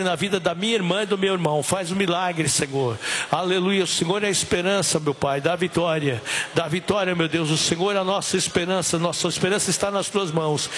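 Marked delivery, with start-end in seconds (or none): none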